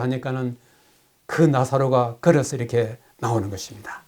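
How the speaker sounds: ordinary talk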